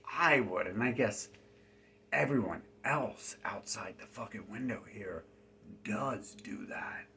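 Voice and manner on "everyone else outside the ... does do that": whisper. Real, not acted. Paranoid aside about people outside.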